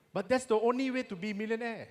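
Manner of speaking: ordinary talk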